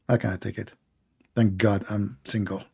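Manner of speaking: usual speaking voice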